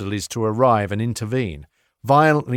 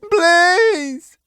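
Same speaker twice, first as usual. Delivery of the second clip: high, loud whiny plea; pathetic high-pitched begging; 6 dB louder than usual. A narrator's reading. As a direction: beg in a squeaky high voice. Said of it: "pathetic, high-pitched voice".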